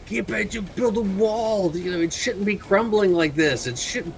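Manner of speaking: high-pitched mock yell at a worker; acted yelling. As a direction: scold like a frantic foreman, without real volume. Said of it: "like, yelling".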